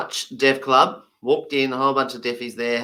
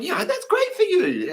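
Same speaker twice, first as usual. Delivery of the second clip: high, laughing polite reply; awkward laugh. Retold or imitated. English mate answering the old man, high awkward laugh. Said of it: "he's going".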